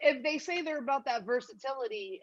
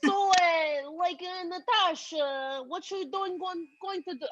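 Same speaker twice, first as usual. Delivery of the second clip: put-on Russian accent; 6 semitones higher than usual. Host gives clue in guessing game, high fake Russian accent. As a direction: attempt a Natasha-style Russian accent, pitched up. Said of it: "I'm Russian".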